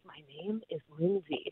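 re-enacted whisper in his ear. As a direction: whisper your name as if into someone's ear. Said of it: "I whispered in his ear my name".

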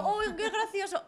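put-on delighted reaction; fake amusement. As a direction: pretend to find it funny, laughing along. Acting her fake polite laughing reaction.

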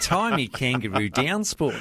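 slow, high Aussie twang through a laugh; hammed-up Aussie accent. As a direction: lay the Australian accent on thick. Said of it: "we'll see if you can maintain that".

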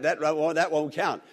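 usual speaking voice

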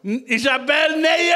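high, rising shout of dismay; loud, dragged-out scolding "no"; mock outraged protest. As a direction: cry out in exaggerated disbelief. Mock outrage at audience member's choice.

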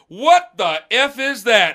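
high, loud, drawn-out outrage; imitated angry dad. Dad spotting son's burned shoulder, loud mock outrage. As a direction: demand it slowly as an appalled dad. Retold dad spots the burn.

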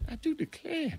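high, soft mock Southern accent; Southern belle. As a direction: imitate a Southern belle quietly. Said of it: "I'm just a Southern belle".

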